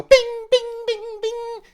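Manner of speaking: very high, ringing "bing" noises; ping like buttons popping off one by one; imitated pinging buttons. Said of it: "the buttons go".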